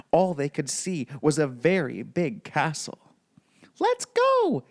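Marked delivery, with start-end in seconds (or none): 3.8-4.6 high, excited cartoon mouse shout; Mickey crying out. A narrator's reading. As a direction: squeak it out eagerly like Mickey. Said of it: "cried Mickey".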